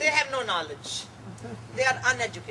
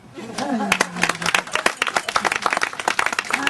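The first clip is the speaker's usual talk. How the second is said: loud laugh; 3 semitones lower, 8 dB louder than usual. Real, not acted. Laugh closing the set.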